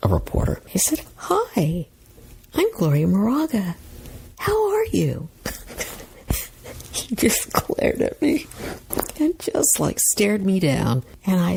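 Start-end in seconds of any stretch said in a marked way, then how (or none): 1.2-5.3 put-on sweetness, climbing very high at the end; charming introduction in a high, polite voice; really nice greeting. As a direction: sing out a very high, nice hello. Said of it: "saying my name really nicely".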